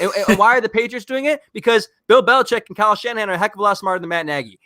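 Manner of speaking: heated, high-pitched venting; exasperated rant. Real, not acted. Fan demanding why we have to see him, exasperated.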